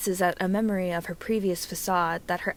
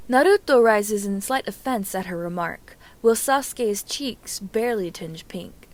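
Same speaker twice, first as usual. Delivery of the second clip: plain reading voice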